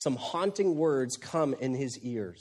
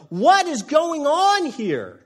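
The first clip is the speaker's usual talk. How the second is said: much higher, louder, slow yell; exasperated yell; 13 semitones higher, 9 dB louder, slower than usual. Retold or imitated. Crew yelling at Jonah, slow and much higher. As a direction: throw up your hands and shout it out. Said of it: "everyone's yelling in at Jonah".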